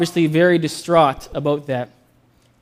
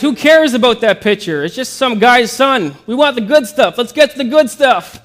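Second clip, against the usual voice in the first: loud, high impatient heckler; annoyed heckler; 6 semitones higher, 5 dB louder than usual. Acted. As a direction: shout it from the back, impatient. Heckler in back of auction.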